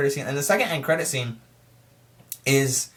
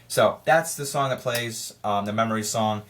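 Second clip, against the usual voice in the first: usual speaking voice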